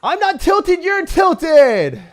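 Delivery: very high, loud shriek; mock shouted retort. Streamer denies tilting to chat, shrieking. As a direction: shriek it at full volume in a piercing pitch.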